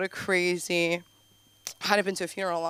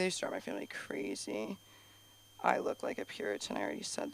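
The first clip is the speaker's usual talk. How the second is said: muttered aside; 10 semitones lower, 12 dB quieter, faster than usual. Shown as a demonstration. Repeats line under breath.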